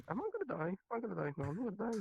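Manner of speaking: a light imitation of a drunk panicking; mock panic. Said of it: "this is the point when I'm getting scared".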